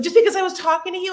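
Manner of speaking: rapid, exasperated mock comeback; mock outrage. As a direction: toss it back as an incredulous retort. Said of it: "She's like".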